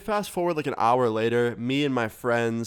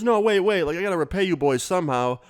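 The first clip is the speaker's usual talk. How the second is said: insistent; 6 semitones higher than usual. Retold or imitated. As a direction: stop them leaving, eager and pushy. Stranger insisting kids stay.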